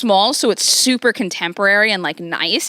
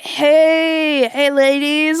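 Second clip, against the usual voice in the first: put-on drunk boyfriend; 5 semitones higher, much slower than usual. Wasted guy to both confused women, drunken hello. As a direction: imitate a wasted guy greeting two women. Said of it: "he's wasted on the couch".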